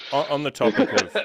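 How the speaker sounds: talking through laughter; laughing. Real, not acted. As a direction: laugh while trying to speak. Laughing mid-sentence.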